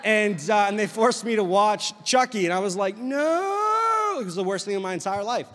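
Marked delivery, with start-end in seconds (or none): 3.0-4.2 very high, long drawn-out cry; mock terrified wail. Preacher as a kid forced to watch Chucky, mock terrified wail. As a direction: stretch it into a child's horrified protest.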